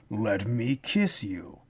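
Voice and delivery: the demon's line read low, slow and soft; flat, weird delivery. Retold or imitated. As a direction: drop it low and drag it out, oddly flat. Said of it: "it was just so weird".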